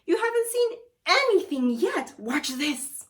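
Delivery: high, loud boastful showman; high excited showman voice; showing off. Acted. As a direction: show off for the kids. Wizard showing off cooking.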